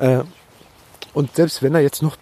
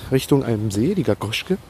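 normal talking voice

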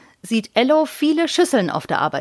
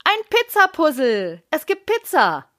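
put-on high, bright excited child's cry; excited shout; 7 semitones higher than usual. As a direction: call it out thrilled, like a delighted kid. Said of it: "ruft Lea begeistert". (begeistert rufen)

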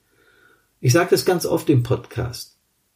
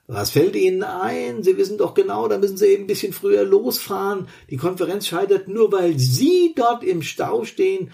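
high, scolding boss voice; angry boss; 6 semitones higher than usual. Acted. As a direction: scold him like an irate boss. Boss scolds late employee.

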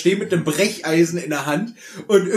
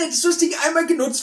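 ordinary delivery

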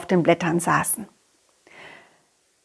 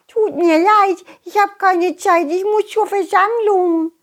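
hurried ladybird; 9 semitones higher than usual. Acted. Ladybird in a hurry.

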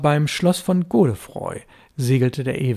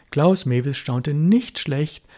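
usual speaking voice

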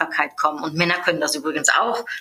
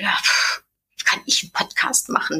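doubtful scoff; 3 semitones higher than usual. Retold or imitated. Doubting herself about the podcast.

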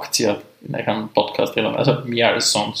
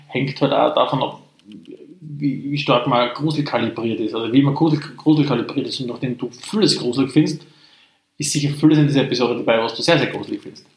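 ordinary talk, nothing marked

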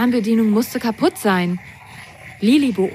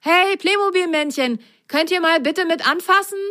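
high, raised call to the figures; 10 semitones higher than usual. A narrator's reading. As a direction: call over for help. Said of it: "rief er".

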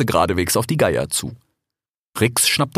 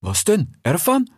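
put-on a slightly higher, friendly young fox; lightly voiced fox; 6 semitones higher than usual. A narrator's reading. Desert fox talking to his brother Rix, lightly voiced. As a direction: play the eager desert fox. Fennec voice.

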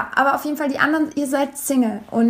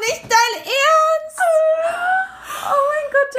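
viel höher, langsam gedehnt vor Unglauben; ungläubig hoch aufquietschen; fassungslos; much slower than usual. Retold or imitated. Zitiert eigene Fassungslosigkeit. (reagiert auf den Kindergartenmann, viel höher und gedehnt fassungslos)

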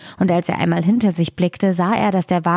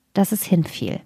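plain reading voice

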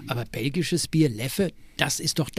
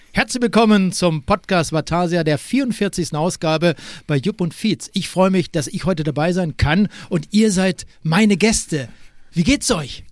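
loud booming presenter welcome; sports announcer voice; 2 semitones higher, 6 dB louder than usual. Acted. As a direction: greet the audience like a TV host. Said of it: "Die Stimme kenn ich".

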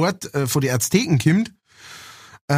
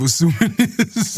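laughing; 4 semitones higher, slower than usual. Real, not acted. Laughs after nose-pinched humming.

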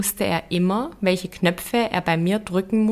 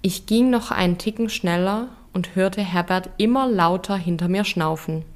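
ordinary delivery

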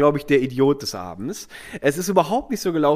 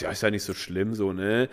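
low, soft aside, it's no big deal; mild hushed consolation; quiet reassurance; 6 semitones lower, 7 dB quieter than usual. Retold or imitated. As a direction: murmur it just to her. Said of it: "ein bisschen lauter so zu ihr".

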